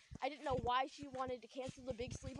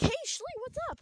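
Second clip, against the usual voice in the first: high, loud, bright hello to a long-lost friend; excited greeting; 12 semitones higher, 7 dB louder than usual. Retold or imitated. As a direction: greet her loud and high, thrilled she picked up. Calling her friend after silence.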